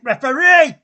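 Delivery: loud, high angry yell; yelling at the ref. Real, not acted. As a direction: bellow at the referee. Fan yelling at the referee.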